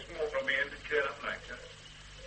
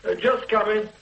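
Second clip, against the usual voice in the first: loud, raised reply; husband calling back that he's coming, raised voice; 8 semitones higher, 7 dB louder than usual. Acted. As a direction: shout that you're on your way.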